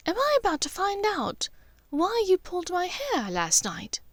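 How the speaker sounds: high, amused knowing tease. A narrator's reading. Narcissa teases her son at tea, high knowing lilt. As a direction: lilt it up, she already knows. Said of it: "Narcissa asked knowingly".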